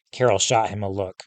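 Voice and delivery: ordinary delivery, nothing marked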